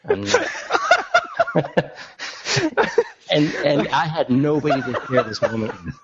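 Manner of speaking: talking through real laughter; high breathless laughing speech. Real, not acted. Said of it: "I think [name]'s gonna pass out".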